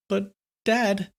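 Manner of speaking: put-on high-pitched frightened boy; trembling voice. A narrator's reading. Frightened son speaks to his father, trembling voice. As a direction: plead in a small, high, trembling voice. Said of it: "said with a trembling voice".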